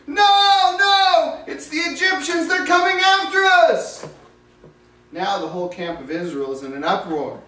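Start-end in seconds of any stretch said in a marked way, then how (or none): 0.1-4.0 high, loud terrified cry from the crowd; panicked scream. Acted. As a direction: yell the warning at the top of your voice, much higher. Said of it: "someone from the back starts screaming".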